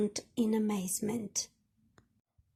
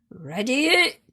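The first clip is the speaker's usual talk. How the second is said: shouted; 5 dB louder than usual. Acted. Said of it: "shouted Percy".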